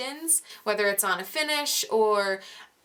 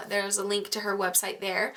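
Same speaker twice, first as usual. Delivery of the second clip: ordinary delivery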